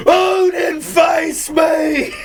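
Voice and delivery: high, drawn-out theatrical yell; yelling at the heavens. Shown as a demonstration. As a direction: bellow a challenge up at the sky. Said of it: "just yell towards the heavens".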